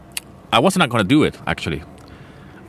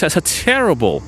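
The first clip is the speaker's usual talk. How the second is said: ordinary talk, nothing marked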